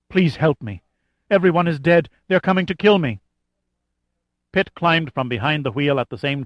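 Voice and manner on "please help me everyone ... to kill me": frightened pleading. A narrator's reading. Fleeing woman begging for help.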